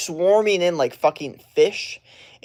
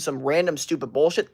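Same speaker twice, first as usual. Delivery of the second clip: ordinary delivery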